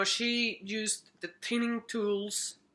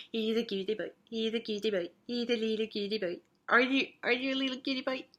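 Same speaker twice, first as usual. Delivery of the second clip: put-on baby talk to pet; 3 semitones higher, faster than usual. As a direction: coo at the little pet like a baby.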